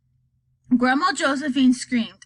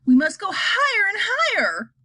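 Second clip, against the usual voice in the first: excited; 10 semitones higher, 3 dB louder than usual. Acted. Wonka excited.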